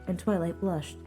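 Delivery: ordinary delivery, nothing marked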